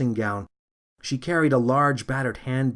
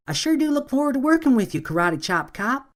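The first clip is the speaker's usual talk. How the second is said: put-on much higher, fast, chirpy young officer voice; eager young rookie greeting her new partner; 13 semitones higher than usual. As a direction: beam with eager rookie enthusiasm. Eager rookie Becky speaks.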